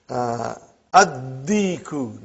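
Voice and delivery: assimilated d in Addiku, slow. Shown as a demonstration. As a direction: say Addiku, no lam, the d doubled. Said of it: "not aldiku".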